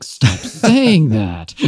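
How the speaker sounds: slow, drawn-out plea broken by a laugh; laughing mock protest. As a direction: laugh through a mock complaint.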